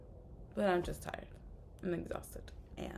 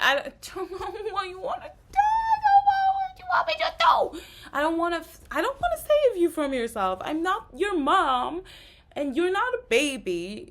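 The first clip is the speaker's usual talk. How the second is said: real exasperated shout, much higher and louder, voice cracking; venting at someone she can't save; cracking near-sob shout of frustration; frustrated yelling; 11 semitones higher, 10 dB louder than usual. Real, not acted. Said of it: "you can really hear that right now".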